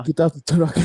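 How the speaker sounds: Russian accent with a chuckle; mock Russian accent. Shown as a demonstration. Showing a friend the guard's order, mock Russian accent. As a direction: show off a jokey Russian accent. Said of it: "let's hear your Russian accent".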